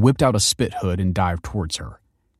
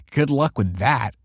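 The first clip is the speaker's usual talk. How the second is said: short snort, then higher scoffing; derisive snort; 5 semitones higher than usual. A narrator's reading. Pupil dismissing the principal's warning, snorting. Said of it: "snorted Kelsey".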